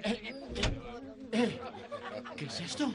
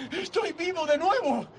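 laughing with relief; overjoyed laugh, alive again; joyful laughter; 5 semitones higher than usual. Acted. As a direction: laugh it out, giddy with joy.

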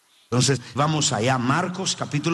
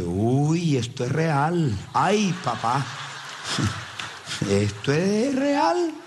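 startled; 3 semitones higher, much slower than usual. Retold or imitated. Pastor retells his own fright.

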